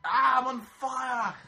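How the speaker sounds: shout. Real, not acted. Mock shout while burning hair.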